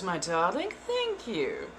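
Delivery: normal talking voice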